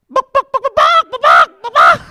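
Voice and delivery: very loud, high-pitched chicken squawks; piercing comic hen impression; chicken clucking. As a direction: cluck like a hypnotized chicken, full blast. Hypnotized dad clucks like a chicken.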